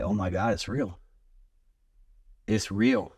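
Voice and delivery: hushed stunned awe; awed disbelief. Retold or imitated. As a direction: breathe it out in wonder. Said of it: "And I'm just like".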